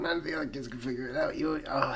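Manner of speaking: ordinary delivery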